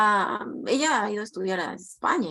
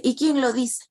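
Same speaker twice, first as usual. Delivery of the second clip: mildly haughty retold challenge, pitched up; 4 semitones higher, 4 dB louder than usual. Retelling a friend's challenge to her, mildly haughty. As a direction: imitate a friend's arrogant comeback, lightly. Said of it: "yo sí la sentí soberbia cuando me dijo".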